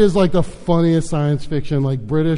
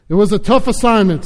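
usual speaking voice

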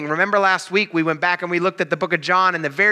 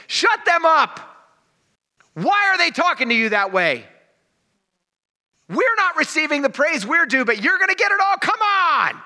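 high, whining, indignant priest voice, much higher; whiny chief priests; 12 semitones higher than usual. Retold or imitated. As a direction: whine like jealous priests demanding their due. Chief priests voiced, jealous, complaining.

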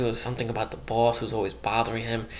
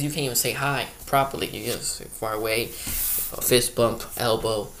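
ordinary delivery

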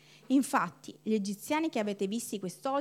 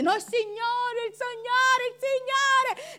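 high, slow, stretched pleading of the Signore; desperate Moses; 10 semitones higher, slower than usual. Retold or imitated. Moses before the angry crowd, high desperate pleading. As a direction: plead it much higher, drawing it out. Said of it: "questi che erano tutti arrabbiati".

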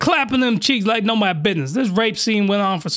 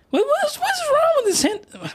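falsetto exasperation. Real, not acted. Exasperation returns.

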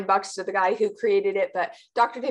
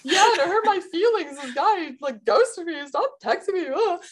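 high, laughing fake sob voice, hammed up; mock weepy heartbreak; 9 semitones higher than usual. Joking about crying at a sad movie, mock heartbroken wail. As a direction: wail it like a soppy heartbroken teen, half laughing. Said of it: "I'm just, like, crying".

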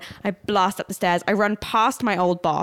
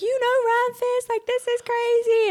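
mimicked excited friend; 13 semitones higher than usual. Voices friend's excited messages.

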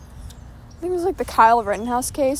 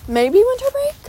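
high, louder unsure question; 12 semitones higher, 4 dB louder than usual. Real, not acted. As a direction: toss it up high, doubtful. Second guess, much higher.

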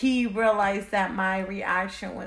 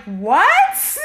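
sudden loud high shocked cry; loud stunned what; 8 semitones higher, 10 dB louder than usual. Retold or imitated. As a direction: blurt it out in disbelief. Shocked at pregnancy news.

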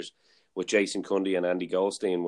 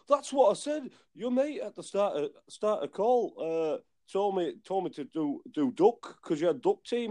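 high-pitched mock indignation; put-on aggrieved voice, well up in pitch; protesting caller; 11 semitones higher than usual. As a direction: imitate a baffled caller protesting, halting and high. Retold confused caller protesting.

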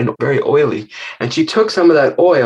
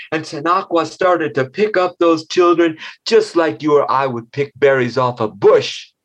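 ordinary delivery, nothing marked

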